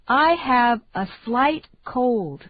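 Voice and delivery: ordinary talk, nothing marked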